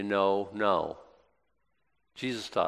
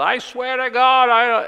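high, loud, slow desperate oath as Peter; Peter's oath; 14 semitones higher, 8 dB louder, slower than usual. Retold or imitated. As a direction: swear it like a cornered man, voice up high.

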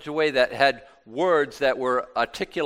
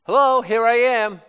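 mock booming hello; 9 semitones higher, 8 dB louder than usual. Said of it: "God opens up the sky and says".

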